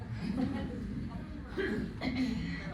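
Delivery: high, breathy nervous laugh. Real, not acted. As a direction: laugh off the stage fright. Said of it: "I hate public speaking".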